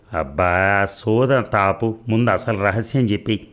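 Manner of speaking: ordinary delivery, nothing marked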